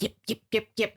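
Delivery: high yappy yip yip noises; mock yapping like a small dog; yapping mimic. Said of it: "it was just like".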